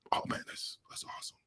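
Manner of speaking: mock whisper of fake praise; whispered yes-man praise for the promoter. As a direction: breathe it out with no voice, like flattering the boss.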